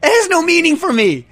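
high, loud, laughing disbelief; incredulous outburst. Real, not acted. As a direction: explode in baffled disbelief. Incredulous at "fire-baked style.

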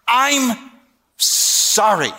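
high, broken-up mock apology; forced apology. Boy made to apologize to his sister, hammed-up reluctance. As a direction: squeeze out each word separately, high then falling.

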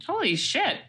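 high, startled holy shit; reacting to a dramatic history teaser, startled; mock shock. Real, not acted. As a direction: gasp it out in surprise.

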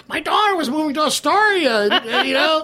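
mocking a New York Times diary writer, high laughing parody; mock fearful writer. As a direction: send up the pearl-clutching diarist.

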